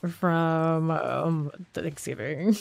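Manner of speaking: stuffy nose from a cold; sniffly, blocked-nose voice; congested. Real, not acted. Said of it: "congested and sniffling".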